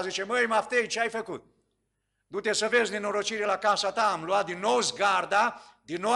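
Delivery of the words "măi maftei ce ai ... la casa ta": higher, louder reproach; scolding call. Retold or imitated. Villagers waiting at night for the sorcerer: loud reproach. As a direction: call him out in alarm.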